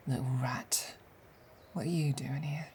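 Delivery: soft, sneering whisper; hushed contempt. Acted. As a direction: spit the insult quietly.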